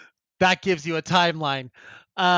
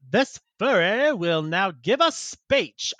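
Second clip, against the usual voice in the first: slow, higher-pitched Southern orator; mock Confederate Southern; 4 semitones higher, slower than usual. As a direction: proclaim it in a hammed-up Southern drawl. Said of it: "like, Confederate Southern".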